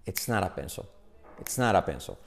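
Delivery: "It's not a pencil" as one low word, "etsnára penso"; native linked pronunciation. Shown as a demonstration. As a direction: tap the T of "not a" like a soft R. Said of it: "unen todo así".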